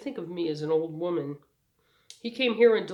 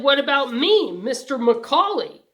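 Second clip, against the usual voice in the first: higher, louder demand; boy protesting to the coach, louder; righteous indignation; 7 semitones higher, 11 dB louder than usual. Acted. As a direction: protest the unfairness out loud. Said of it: "overflowing with righteous indignation".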